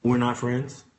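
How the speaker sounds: low, soft, flat-voiced line; quiet, deadpan mock of Mister Willie; deadpan retort. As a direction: drop the voice and shut him down. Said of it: "I said".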